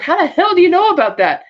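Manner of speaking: high-pitched outraged retelling; mock indignant demand. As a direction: shoot the question up high, affronted. Retold startled, angry demand.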